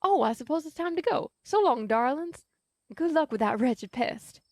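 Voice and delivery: a hint of a genteel Southern lady taking her leave; Southern belle saying goodbye with a flourish; Southern belle accent. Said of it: "get back to the accent by going".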